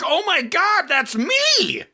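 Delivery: high, excited squeal of recognition; gushing fan. Retold or imitated. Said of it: "And you're like".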